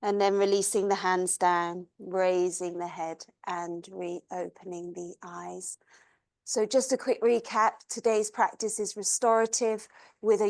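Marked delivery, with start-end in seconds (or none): 0.0-5.8 soft, even voice with long pauses; guided meditation. Acted. Yoga teacher leads class through long exhales, guided meditation.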